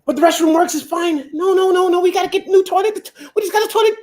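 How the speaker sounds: hammed-up wife insisting on a new toilet; mock woman. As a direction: whine like a demanding wife who wants a new toilet. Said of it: "That's how they think".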